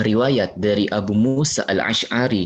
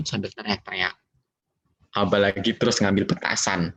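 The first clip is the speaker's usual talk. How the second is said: normal talking voice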